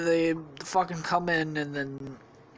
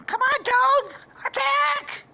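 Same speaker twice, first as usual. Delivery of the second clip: very high, loud squeaky shout; rally the troops in a shrieked falsetto; toad battle cry; 6 dB louder, slower than usual. Acted. Toad leading the attack, squeaky battle cry.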